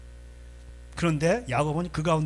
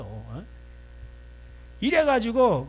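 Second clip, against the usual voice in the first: ordinary talk, nothing marked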